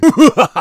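high, loud short laugh; loud burst of laughter. Pretend. Host after the Kraft Empire line, loud high laugh. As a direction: let out a sudden big laugh.

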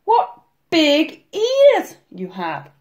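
put-on high, slow, astonished girl; slow-motion little-girl amazement; said slowly. A narrator's reading. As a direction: spell out each word in wonder. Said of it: "she said slowly".

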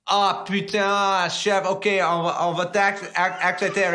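a fed-up chef finally giving in; hammed-up annoyed French chef; exasperated French chef. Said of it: "he goes".

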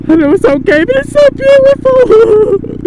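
swooning over a beautiful sunrise; gushing high voice. Real, not acted. Sun timelapse, delighted.

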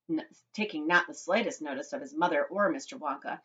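plain reading voice

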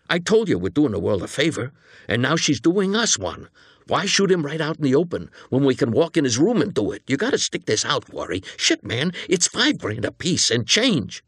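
eager, pressing persuasion; agitated junior accomplice. Acted. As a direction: talk your reluctant partner round, voice climbing. Plays the agitated accomplice pitching the job.

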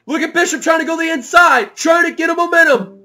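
high, shouted race call; excited play-by-play. Real, not acted. Commentator following the last-lap charge, shouted excited play-by-play. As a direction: shout each move as it happens.

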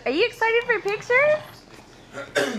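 high, slow eager question; excited question. Real, not acted. Wife asking husband about photos, high eager question. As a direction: sing it out, eager for his yes.

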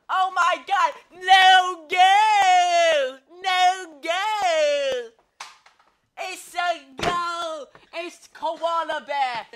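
very loud, high no-goal yells; frantic shouting. Pretend. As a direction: scream the reversal, then the win. Commentator screaming no goal then goal.

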